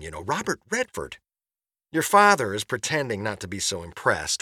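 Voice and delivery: high-pitched, gushing mom on voicemail; thrilled mother. Acted. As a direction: burst with pride, barely containing the news. Mother's thrilled second message.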